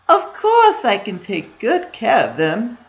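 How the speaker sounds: high, slow cackling witch; sweet-talking old witch, drawn out. A narrator's reading. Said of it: "said the witch".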